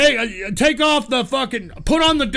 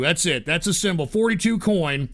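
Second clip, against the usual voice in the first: ordinary talk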